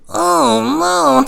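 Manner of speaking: high, loud, drawn-out wail; hammed-up cry of dismay; mock wailing. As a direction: wail helplessly, dragging the words out. Helpless mock wail at climax.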